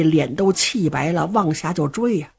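plain reading voice

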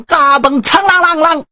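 high, ringing sword clang; sword sound effect. A narrator's reading. Storyteller voices the sword drawn from its sheath. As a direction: ring out the blade being drawn.

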